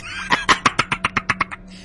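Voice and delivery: high staccato giggle; teasing laugh. Acted. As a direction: break into a mocking cackle. Laughing while promising secrecy. (ضحكة تريقة متقطعة)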